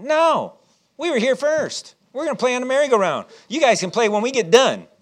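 put-on high, bold kid voice refusing to leave; childish defiance. Kids defy teenagers, child voice.

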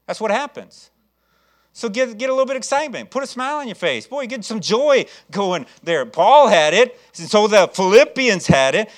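rousing, emphatic sermon delivery; fervent preaching. Real, not acted. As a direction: urge the congregation to get excited. Exhorts congregation to get excited.

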